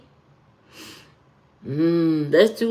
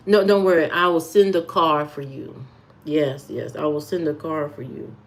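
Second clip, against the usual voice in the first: lower, composed gentleman reassuring his date; smooth businessman act; 4 semitones lower than usual. Acted. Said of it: "that kind of energy".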